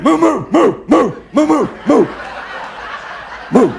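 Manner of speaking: angry cow moo. Retold or imitated. Cow chasing the mailman, loud angry mooing. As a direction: bellow moos like a furious cow. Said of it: "from a pissed off cow".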